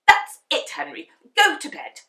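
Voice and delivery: loud, high furious shout; angry shout. A narrator's reading. Mum sending Henry to bed, shouting. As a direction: shout the order at him. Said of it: "shouted Mum".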